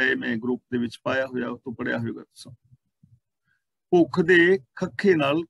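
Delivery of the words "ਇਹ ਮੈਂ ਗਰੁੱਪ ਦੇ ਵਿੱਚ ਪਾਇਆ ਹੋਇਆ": ordinary delivery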